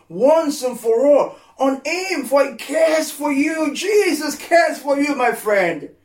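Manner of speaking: preaching at full force. Real, not acted. Preacher urging his flock, tearful rising preaching. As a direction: let it swell into a tearful cry.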